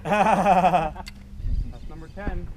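把“That's number ten”拉得很长很慢，像颁奖宣布; drawn-out announcement. Real, not acted. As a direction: stretch every word like a ringside announcer. Milestone tenth fish, drawn out.

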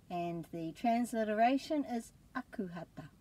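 usual speaking voice

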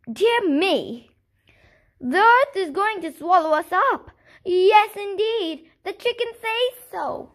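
high, loud panicked townsfolk; crying out in alarm. A narrator's reading. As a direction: shriek it in panic. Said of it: "they began to cry out".

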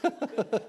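hearty high laugh at his own joke; real laugh. Real, not acted. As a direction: let the laugh break out after the punchline.

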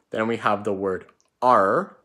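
normal talking voice

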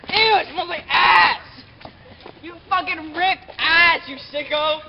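loud, slow disgusted shout. Real, not acted. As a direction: recoil and yell in disgust. Friend broke wind, disgusted outburst.

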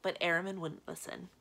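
ordinary delivery

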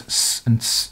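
s made as a bare "sss" sound, twice; two isolated s sounds joined by "and"; sibilant demo. Shown as a demonstration. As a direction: sound out each s, not the letter name. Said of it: "Unvoiced fricatives and sibilants".

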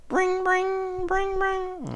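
high sung bring bring like a ringing phone; phone ring imitation. Shown as a demonstration. High phone ring imitated for the pets. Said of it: "Have you heard a phone go".

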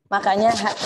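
ordinary talk, nothing marked

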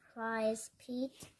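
usual speaking voice

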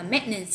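"maintenance" reduced to met-nence; dropped middle sound. Shown as a demonstration. Teacher repeats what is heard in exam audio, reduced "maintenance". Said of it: "người ta sẽ bỏ cái âm ch".

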